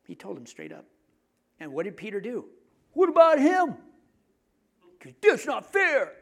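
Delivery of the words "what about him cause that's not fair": put-on much higher, louder childish whine; whiny protest. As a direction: complain like a sulky kid. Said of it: "I'm sure that's how he said".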